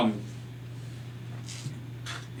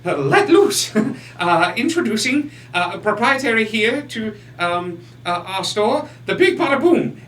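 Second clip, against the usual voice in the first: sales pitch; 7 semitones higher than usual. Acted. Shopkeeper's grand sales pitch.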